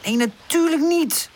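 high, cross retort; understated storybook anger, high-pitched; angry. A narrator's reading. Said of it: "zegt Pip boos".

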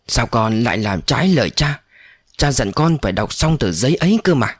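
ordinary delivery, nothing marked

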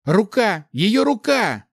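high, loud panicked shout; alarmed cry. A narrator's reading. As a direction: shout a frantic warning. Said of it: "раздался крик Эмиля". (тревожный крик)

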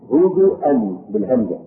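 ordinary talk

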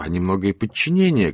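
ordinary delivery

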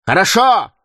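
a sharp loud exclamation; shouting. A narrator's reading. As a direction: shout it out in defiance. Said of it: "вскричал Слейтон". (громкий возглас)

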